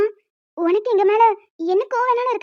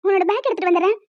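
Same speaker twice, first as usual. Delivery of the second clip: normal talking voice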